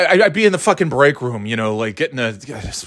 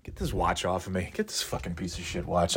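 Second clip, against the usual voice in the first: low, much softer grumble; muttered disgust; 7 semitones lower, 12 dB quieter than usual. Real, not acted. Venting to the audience about the watch, low muttering. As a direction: mutter it under your breath, fed up.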